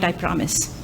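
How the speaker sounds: usual speaking voice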